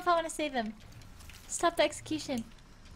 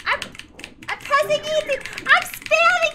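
much higher, louder insistence; frantic shouting; 14 semitones higher, 8 dB louder than usual. Real, not acted. Streamer insisting to the game she is pressing E. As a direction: yell that you're doing it.

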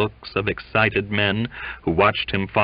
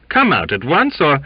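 loud, raised order; threatening command, higher and louder; 8 semitones higher, 6 dB louder than usual. A narrator's reading. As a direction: warn him with an order. Captain ordering the cook out.

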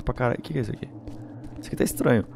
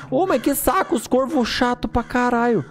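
annoyed; 10 semitones higher than usual. Real, not acted. Annoyed by noisy crows.

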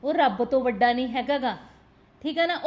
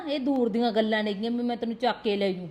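ordinary delivery

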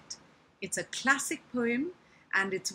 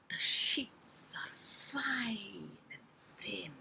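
slow whispered spider; seductive whisper; 7 dB quieter, much slower than usual. Acted. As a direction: drop to a slow, luring whisper. Spider luring the fly.

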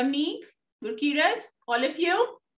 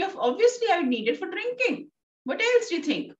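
high-pitched mock exasperation; very angry; 8 semitones higher, faster than usual. Teacher voicing the mother's answer about drinking, angry. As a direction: paraphrase it as an irritated, high retort. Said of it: "So she's very angry".

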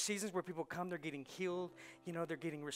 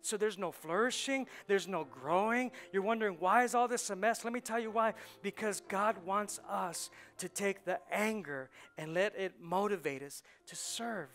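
forceful preacher, building louder; full-force preaching; 3 semitones higher, 5 dB louder than usual. Real, not acted. Preacher warning church-hoppers they have no root, full-force preaching. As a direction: press it home from the pulpit.

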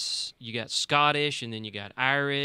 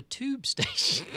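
slow, higher mock-British; British accent; 13 semitones higher, slower than usual. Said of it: "practice your British accent".

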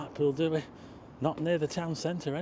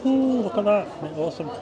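higher, louder, delighted ooh over a treat; 6 semitones higher, 6 dB louder than usual. Real, not acted. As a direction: gush over the scone. Sees his scone and tea.

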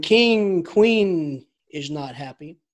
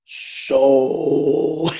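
grand epic opening; 5 semitones lower, 3 dB louder than usual. Retold or imitated. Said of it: "it starts with".

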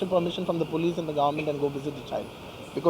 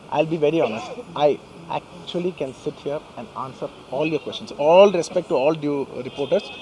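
ordinary talk, nothing marked

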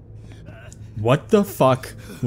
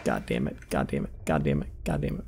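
much lower, softer repeated grumble; low muttering; 9 semitones lower, 7 dB quieter than usual. Real, not acted. Frustrated gamer muttering at the game, low and soft. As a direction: grumble it under your breath.

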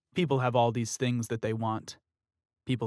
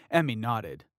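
usual speaking voice

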